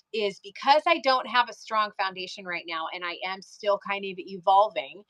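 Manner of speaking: usual speaking voice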